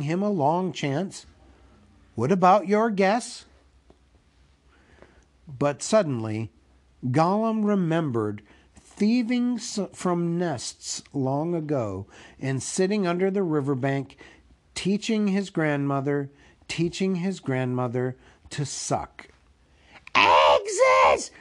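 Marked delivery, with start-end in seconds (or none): 2.2-3.4 higher, louder hobbit question; impatient prompting. Acted. Impatient Bilbo presses Gollum. 20.1-21.3 loud, hissing creature outburst; hissed. Acted. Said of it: "he hissed".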